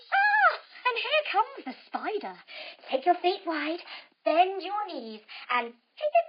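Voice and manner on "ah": high, loud startled shriek; a child-friendly hammed-up cry of alarm; mock scream. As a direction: yelp as if stuck in a web. Bee caught in spider web.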